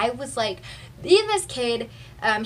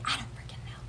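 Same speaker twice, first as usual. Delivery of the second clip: unvoiced muttered aside, much softer; whisper; 13 dB quieter, faster than usual. Real, not acted. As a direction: throw it away under your breath. Flustered aside, voice drops away.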